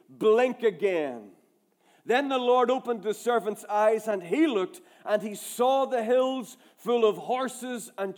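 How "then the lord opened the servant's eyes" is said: usual speaking voice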